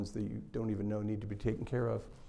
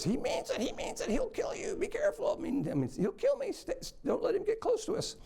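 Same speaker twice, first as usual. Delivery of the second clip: tiny, much higher lizard voice pleading in a rush; frantic little lizard. Retold or imitated. The lizard begs the young man not to let the angel kill it, in a tiny, high, frantic voice. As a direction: squeak it like a panicked lizard begging for its life.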